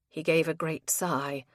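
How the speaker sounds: plain reading voice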